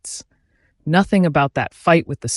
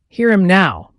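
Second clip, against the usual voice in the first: louder and higher, a full shout; 5 semitones higher, 7 dB louder than usual. A narrator's reading. Peleg to Bildad, mocking his piety, shouting. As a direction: belt it out mockingly. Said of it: "Peleg shouted".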